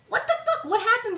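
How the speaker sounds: very high-pitched disbelief; baffled outburst. Acted. Fan reacting to the sudden change in Rey, baffled outburst. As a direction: squeak it out in bewilderment.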